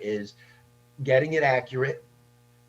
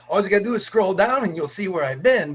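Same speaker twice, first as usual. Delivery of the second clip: loud, raised quoted comeback; retold emphatic retort; 6 semitones higher, 7 dB louder than usual. Guest retelling his answer to people on Facebook, emphatic. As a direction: fire back at the commenters, high and loud. Acts out his reply to commenters.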